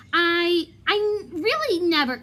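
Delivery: grasping for excuses; flustered. Acted. Lockhart flustered denial.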